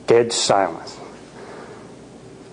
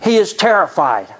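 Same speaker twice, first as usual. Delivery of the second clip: plain reading voice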